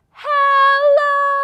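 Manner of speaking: high, loud shouted hello; loudest hello. Shown as a demonstration. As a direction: shout it as loud as you can. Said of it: "as loud as we can".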